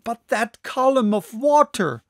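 high, loud alarmed exclamation; loud protest about the waterspout; urgent protest. Acted. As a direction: point at the danger, pressing. Narrator protests to professor.

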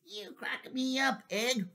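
high amused voice; giggly waffle. Acted. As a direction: tease Egg fondly. Waffle laughs with Egg.

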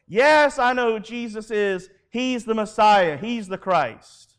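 loud, high confession easing down; bold proclamation. Pretend. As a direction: declare it to an unbelieving world. Imagined confession to the world.

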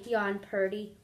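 usual speaking voice

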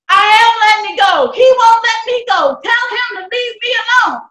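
loud, high-pitched indignant outburst; angry protest. Acted. Daughter argues with mother about her father, angry.

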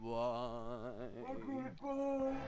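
muffled, drawn-out distant call; far away voice. Pretend. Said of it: "I was doing the far away voice".

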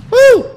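very high, loud "woo"; short startled whoop at full volume; sudden whoop. Real, not acted. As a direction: let out a piercing high whoop. Startled whoop during close fight.

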